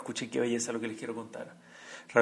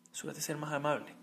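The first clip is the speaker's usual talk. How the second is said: usual speaking voice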